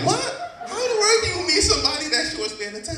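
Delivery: high, loud, fast shocked "what"; shocked disbelief. Retold or imitated. Boyfriend hearing she met someone, high and loud shock. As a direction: react as if you can't believe it. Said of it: "And I'm like".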